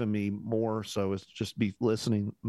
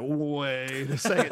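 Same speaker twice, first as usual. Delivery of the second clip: laughing; 5 semitones higher, 3 dB louder, much slower than usual. Retold or imitated. Quoting Jonathan, laughing.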